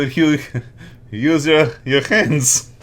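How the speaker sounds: ordinary delivery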